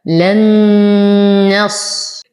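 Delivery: لن نص with long nasal hum on the merged noon; full ghunna. Shown as a demonstration. Teacher showing merging with full ghunna, long nasal hum on لن نص. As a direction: draw out the nasal noon in لن نص, slowly. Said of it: "إدغام مع غنة كاملة".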